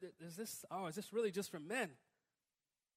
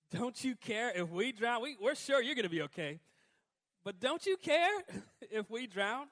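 mock whine; 4 semitones higher, 7 dB louder than usual. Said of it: "it's easy to say".

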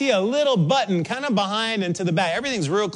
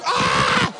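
imitated child's wail; 8 semitones higher than usual. Shown as a demonstration. Young kid reacting after a fall, imitated shriek. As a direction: wail like a toddler copying the parent's alarm. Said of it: "then they react".

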